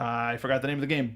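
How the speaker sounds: usual speaking voice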